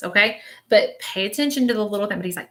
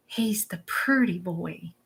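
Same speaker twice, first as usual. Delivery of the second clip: teasing whisper; 6 dB quieter, much slower than usual. Retold or imitated. Spirit teasing about pretty boy.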